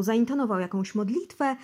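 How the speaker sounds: ordinary talk, nothing marked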